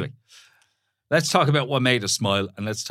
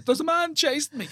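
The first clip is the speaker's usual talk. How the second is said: high, frightened cry for help; panicked woman. Retold or imitated. Woman fleeing into police station, high panicked plea. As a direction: blurt it out in panic. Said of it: "and she goes".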